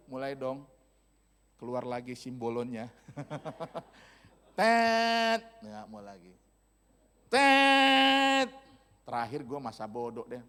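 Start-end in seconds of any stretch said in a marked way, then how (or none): 4.6-5.4 loud, high, held horn blast; long car-horn honk. Retold or imitated. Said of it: "saya klakson sekali". 7.3-8.5 loud, high, held horn blast; long car-horn honk. Retold or imitated. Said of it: "saya klakson sekali".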